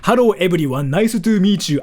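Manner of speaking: heavy put-on Japanese-accented English; exaggerated Japanese accent. Shown as a demonstration. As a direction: lay the Japanese accent on thick. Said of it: "I intentionally spoke English like this".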